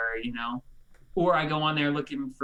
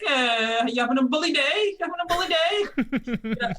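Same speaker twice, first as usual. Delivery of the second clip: high, loud 1920s announcer voice; bright old radio announcer call; old-timey radio voice; 12 semitones higher, 4 dB louder than usual. Retold or imitated. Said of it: "like that old-timey radio voice".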